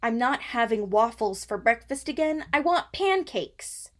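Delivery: put-on loud, high, demanding young lady; bratty young lady. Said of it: "young lady, the heir to the bed and breakfast".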